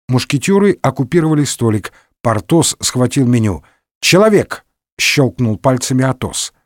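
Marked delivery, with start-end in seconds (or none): 4.0-4.7 calling a waiter. A narrator's reading. Snaps fingers to summon waiter.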